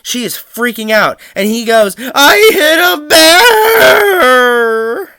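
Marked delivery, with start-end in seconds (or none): 2.0-5.1 high, very slow sobbing wail; imitated bawling. Brother crying to mom on the phone, slow high sobbing. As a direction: blubber it out through tears. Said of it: "just him bawling on the phone".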